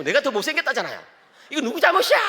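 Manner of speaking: put-on high, loud indignant teen; protesting son. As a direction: throw the blame back like a sulky boy. Son protesting, voiced by dad. (억울해하는 아들 목소리)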